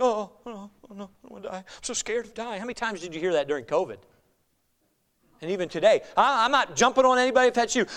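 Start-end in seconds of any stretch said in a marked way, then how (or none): 0.0-2.6 mock fearful. Preacher mimicking believers during covid, mock fearful. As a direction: imitate a timid believer fretting under the breath.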